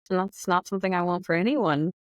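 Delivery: ordinary talk, nothing marked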